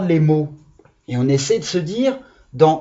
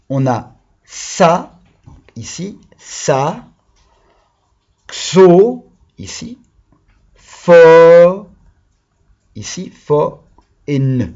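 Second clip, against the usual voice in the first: slow, "xo" and "fo" hit loud; syllable by syllable; 6 dB louder, slower than usual. Shown as a demonstration. Teacher to children, pointing out each syllable. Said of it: "syllable correction with pupils".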